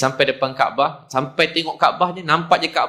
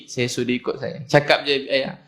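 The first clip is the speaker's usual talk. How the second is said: ordinary talk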